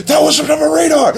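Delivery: high, loud imitation scream; Vegeta. Retelling a favorite joke, mock screaming. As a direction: yell it high, imitating a cartoon outburst. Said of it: "the whole Vegeta screaming thing".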